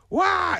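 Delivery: high-pitched mock battle yelp; sudden high attack noise; attack cry. Bumblebee lunges at the rider's knee, attack cry.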